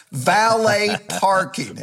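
comic slow emphasis on "valet parking"; incredulous repeat. Real, not acted. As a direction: hit "valet parking" slowly, word by word, in mock disbelief. Repeats absurd detail for comic effect.

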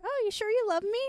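high, coy teasing question; playing coy. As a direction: act innocent and doubtful. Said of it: "Like, trying to play coy".

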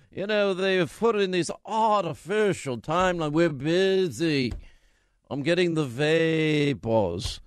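languid whining about the hard life; drawling put-upon senator over music; McConnell voice. Retold or imitated. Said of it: "should I do that voice".

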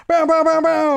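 high "bow-bow-bow" horn blasts; high rapid imitated bow noises; imitated sound effect. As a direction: mimic a soundboard sting, high and ringing. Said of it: "just locked and lo-, loaded".

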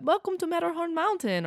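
high-pitched attempt at the Matterhorn ride accent; shaky-attempt Matterhorn accent, sing it out high; Matterhorn announcer accent. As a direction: badly imitate the Matterhorn greeting accent, way up high. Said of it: "I can't do that accent".

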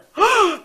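big, high, loud intake of breath; sharp gasp. Retold or imitated. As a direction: gasp as if plunging into water. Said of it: "like this".

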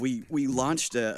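ordinary delivery

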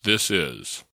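loud, punchy podcast intro read; announcer intro. Acted. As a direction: announce it big, phrase by phrase. Produced show intro, announcer read.

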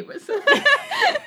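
very high laugh; shrieking laugh. Real, not acted. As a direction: crack up at the slip. Laughs at the accent.

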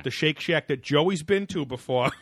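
lightly put-on Boston accent. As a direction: play a Boston guy, slow and loud. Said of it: "I don't know why I'm talking like this".

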